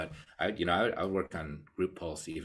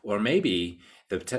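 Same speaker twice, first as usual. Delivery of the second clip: ordinary talk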